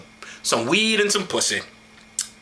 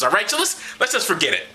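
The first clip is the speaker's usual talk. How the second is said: ordinary delivery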